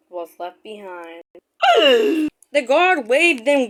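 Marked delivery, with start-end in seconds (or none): 1.6-2.3 gasp. Acted. Edward out of breath.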